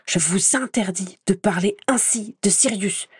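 a hint of a boy shouting at his teacher; restrained angry cry; mild mock yell. A narrator's reading. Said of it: "hurla l'autre".

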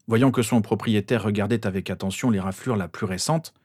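ordinary delivery

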